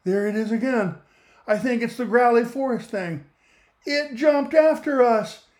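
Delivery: rising panic, the monster is coming; panicky. Acted. Poodle panics about the monster.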